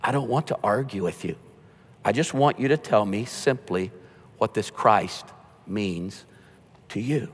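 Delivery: soft, low and unhurried, kindly asking; gently. Retold or imitated. Huxley gently asking the believing guest to explain his faith. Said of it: "Huxley said gently".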